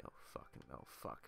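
breathless under-the-breath curse; hushed gamer cursing; tense whisper. Real, not acted. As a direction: whisper so the guard won't hear. Stealth game, sneaking enemy.

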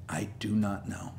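whisper. Real, not acted. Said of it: "unvoiced whisper".

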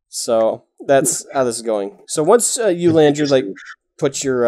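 normal talking voice

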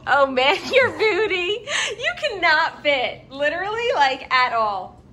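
high laughing through words; laughing hard. Real, not acted. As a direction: crack up while talking. Dog can't fit, laughing.

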